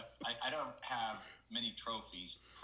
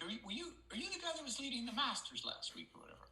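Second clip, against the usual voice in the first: put-on high, lilting Irish accent. Irish golfer on the green asking the stranger's name, in an Irish accent. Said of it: "excuse my Irish accent".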